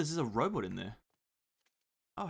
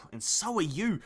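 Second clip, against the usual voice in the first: ordinary delivery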